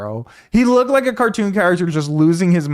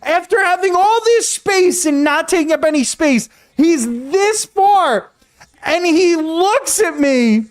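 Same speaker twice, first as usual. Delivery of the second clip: much higher, worked-up storytelling voice; excited retelling; 7 semitones higher than usual. Real, not acted. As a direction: build the story to its wild peak, slowing on key words. Retelling the angry driver climax.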